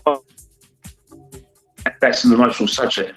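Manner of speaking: usual speaking voice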